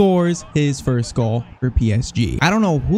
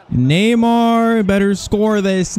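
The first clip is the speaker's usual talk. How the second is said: high, stretched-out Neymar; slow, high, expectant call; drawn-out anticipation; 6 semitones higher, slower than usual. Real, not acted. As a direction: draw the name out high as the chance opens. Live reaction as chance opens.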